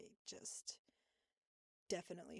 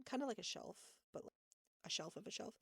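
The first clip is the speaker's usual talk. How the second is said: normal talking voice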